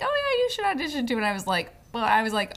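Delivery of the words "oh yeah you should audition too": quick, high-pitched imitation of a friend; eager urging. Friend coaxing someone into auditioning, eager urging. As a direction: pitch it up and rush it encouragingly.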